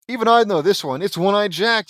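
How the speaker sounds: loud, high, cocky answer; smug know-it-all blurt; scoffing. A narrator's reading. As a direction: scoff as you show them up. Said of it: "buzzing in and scoffing".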